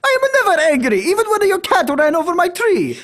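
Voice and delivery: high, loud mock-furious Italian accent; angry Italian. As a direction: rant as a hammed-up, outraged Italian. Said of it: "be as angry as possible as an Italian".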